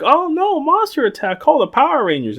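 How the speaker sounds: high squeaky mock panic. Bystanders crying for the Rangers, high mock panic. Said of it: "they're like".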